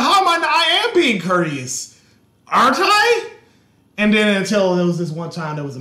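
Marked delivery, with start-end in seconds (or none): 0.0-3.3 mock innocent protest. Retold own protest.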